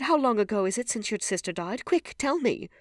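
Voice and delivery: high, loud desperate question; frantic, high-pitched urgency; urgent cry. A narrator's reading. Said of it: "he cried".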